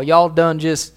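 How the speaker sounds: mock Texan drawl, stretched out; hammed-up Texan southern accent. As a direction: put on a slow Texas accent. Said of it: "They got that southern drawl".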